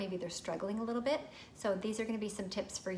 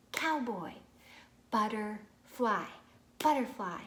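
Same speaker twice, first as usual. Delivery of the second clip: "cowboy" and "butterfly" louder in parts; syllable parts; 4 dB louder, slower than usual. Shown as a demonstration. Parent showing compound words to a child. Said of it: "compound words like cowboy".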